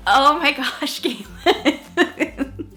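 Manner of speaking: laughing. Real, not acted. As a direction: giggle while scolding yourself. Laughing at old entry.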